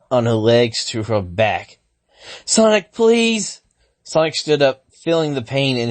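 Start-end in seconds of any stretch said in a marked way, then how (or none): 2.4-3.6 high, slow pleading cry; desperate plea. Acted. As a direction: beg for help, high and drawn out. Sally trapped, pleading.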